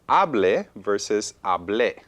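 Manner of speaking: slow, "hable" stressed on HA, then "hablé" stressed on the final BLÉ; hable versus hablé. Shown as a demonstration. Teacher slowly contrasting "hable" and "hablé". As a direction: shift the stress from the first to the last syllable, slowly. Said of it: "the first one is hable ... the second one, hablé".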